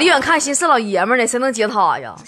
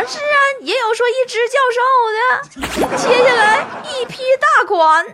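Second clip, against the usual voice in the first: whiny Tuotuo; 7 semitones higher than usual. Retold or imitated. Tuotuo voice continues.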